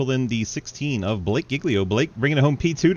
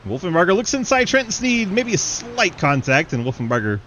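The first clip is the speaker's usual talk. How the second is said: fast live race call; play-by-play; 3 semitones higher than usual. Acted.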